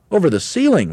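very high, loud chorus of disbelief; astonished cry. A narrator's reading. Monkeys questioning their leader, astonished cry. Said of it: "they cried".

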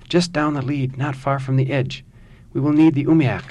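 excited urgency. Acted. Urgent report of the whale.

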